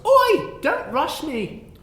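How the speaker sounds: high, loud, slow snap from the dwarf cook; cook's shrill retort. Acted. Dwarf cook snapping at a pushy customer, high and loud. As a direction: bark it back like a busy cook. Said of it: "She turns to you and goes".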